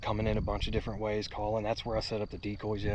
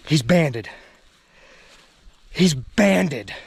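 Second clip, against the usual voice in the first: louder, amazed repeat; excited; 6 semitones higher, 12 dB louder, much slower than usual. Real, not acted. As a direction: savor it, still amazed. Repeats discovery.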